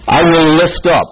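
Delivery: loud proclamation. Acted. Preacher reading God's threat to Israel, proclaimed loudly.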